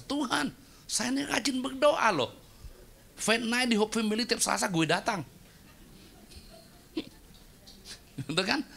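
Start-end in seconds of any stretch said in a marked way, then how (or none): 0.0-5.2 high, complaining voice to God; whiny protest. Retold or imitated. Dead man protesting to God, high and whiny. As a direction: play the offended believer. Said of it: "Dia protes".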